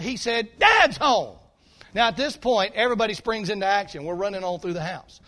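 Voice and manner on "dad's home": loud panicked shout; retold shout of alarm; shouted warning. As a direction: yell it to warn your brothers. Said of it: "as loud as he can shout it".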